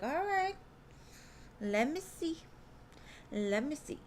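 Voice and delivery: high, rising sing-song "we'll see"; playful onlooker. Acted. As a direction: play a curious spectator settling in to watch. Spectator with popcorn reacting.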